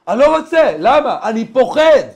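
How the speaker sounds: high, shouted protest; acted frantic refusal. (סירוב מבוהל בצעקה גבוהה)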